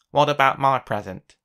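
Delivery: loud indignant cry; cried. A narrator's reading. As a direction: complain loudly about being left out. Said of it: "cried Mister Mean".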